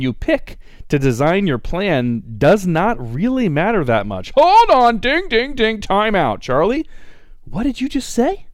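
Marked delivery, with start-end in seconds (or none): 4.4-8.4 high, loud mock alarm; excited interruption. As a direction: call time out on yourself, loudly. Interrupts himself.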